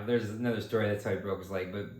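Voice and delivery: ordinary talk, nothing marked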